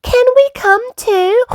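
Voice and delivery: very high eager piglet; Peppa. A narrator's reading. Peppa asking to come along, very high piglet. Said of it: "Peppa said".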